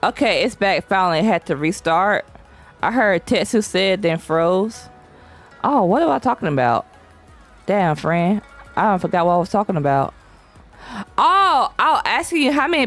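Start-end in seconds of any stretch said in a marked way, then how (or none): none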